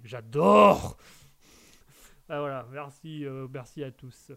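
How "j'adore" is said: mock exclaimed. Mock reply in Mouton's voice.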